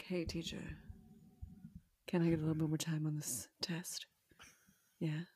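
hushed, reserved and seductive murmur. Acted. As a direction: imitate a soft, serious, sultry star. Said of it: "quiet, reserved talk, very serious, very sexy".

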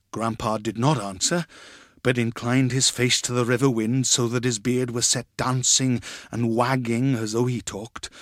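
plain reading voice

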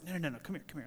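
hushed, hurried aside; stage whisper. Acted. Preacher as Peter drawing Jesus aside, mock stage whisper. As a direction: softly pull someone aside. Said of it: "like a stage whisper".

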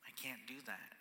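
quiet whispered surrender; whispered admission. Retold or imitated. Person at the squat bar whispering surrender. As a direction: breathe it out as a whisper.

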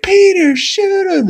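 very high, loud cartoon yell; mock urgent yell. Cleveland tells Peter to shoot the fish, yelling urgently. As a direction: imitate a frantic high-pitched command.

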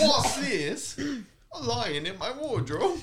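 high hammed-up English accent; exaggerated, high-pitched Cockney. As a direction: squawk it in mock Cockney. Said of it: "You go".

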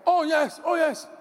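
high, dismissive "oh yes"; mock dismissive reply. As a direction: brush off the compliment, pitched high.

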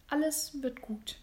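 soft whispered comfort; tender, airy whisper; hushed reassurance. Acted. Husband comforts his wife.

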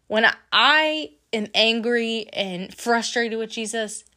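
ordinary delivery

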